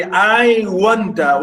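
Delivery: "I wonder" drawn out slowly, both syllables clear; syllable-by-syllable reading. Shown as a demonstration. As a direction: stretch "I wonder." out for a student counting syllables. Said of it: "Wonder, two syllables".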